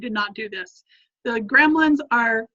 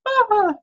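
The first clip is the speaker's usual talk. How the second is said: high sob turning into laughter; laughing through real tears; crying laugh; 9 semitones higher than usual. Real, not acted. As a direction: let out a tearful, embarrassed laugh. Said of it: "this is making me cry".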